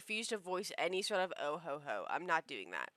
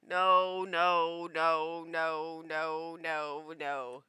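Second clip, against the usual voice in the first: repeated refusal; 5 semitones lower, 5 dB louder, much slower than usual. Acted.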